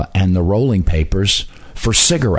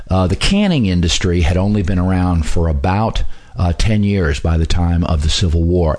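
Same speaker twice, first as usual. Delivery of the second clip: usual speaking voice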